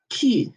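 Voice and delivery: "Q" as "kü", k plus tight rounded vowel; smushed-lip French Q. Shown as a demonstration. Teacher showing smushed lips, "kü" for "Q". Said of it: "smushing those lips".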